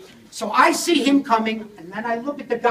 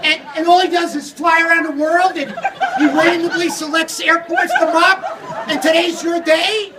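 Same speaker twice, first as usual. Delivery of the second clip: irate rant; 4 semitones higher than usual. Retold or imitated. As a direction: tear into him in mock outrage. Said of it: "I started screaming at the guy".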